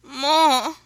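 loud, high, insistent plea; begging. A narrator's reading. Woman urging her lover on, loud begging. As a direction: cry it out, begging hard. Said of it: "she begged".

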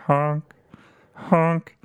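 high warbly crooner voice, honking; silly [name] impression. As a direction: croon it high and wobbly like a goose. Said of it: "He has a voice of a Canadian goose".

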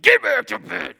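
put-on a woken night-shift dad barking at his kids; gruff tired dad. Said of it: "how I used to treat my boys".

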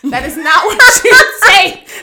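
slow, loud, high-pitched word-by-word correction; mom's emphatic scolding. Retold or imitated. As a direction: hammer each word like an exasperated mom. Repeats mom's correction, far more emphatically.

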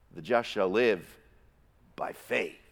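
low build, pause, then "faith" set apart; stress on faith. Shown as a demonstration. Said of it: "each verse almost seems to emphasize a different word".

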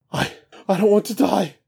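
high, fast, frightened plea; pleading. Acted. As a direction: beg for your life. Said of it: "Jarl Troon pleads".

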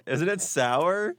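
high, slow shocked question; shocked sour reaction. Real, not acted. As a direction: squeal it out at the sourness.

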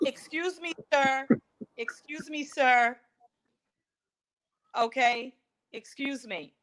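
ordinary talk, nothing marked

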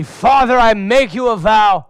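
a kid playing at old-fashioned vows; solemn son. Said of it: "one of my sons says to me".

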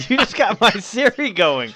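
laughing. Real, not acted. Laughs at phone assistant.

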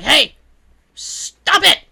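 loud, high imitation of a furious dad yelling; steaming dad. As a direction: bark it like dad fed up with a kid. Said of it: "he's steaming 'cause he hates disrespect".

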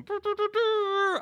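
very high, loud mouth trumpet; imitated trumpet fanfare. Game master imitating the festival trumpets, tooting. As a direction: toot a slow fanfare with the voice. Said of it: "the trumpets are going".

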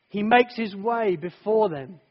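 normal talking voice

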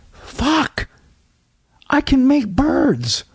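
high, drawn-out cry of amazement; high-pitched astonished outburst; magical freak-out. Acted. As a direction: marvel wildly at your own magic, voice way up. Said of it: "show people how I would act if I was magical".

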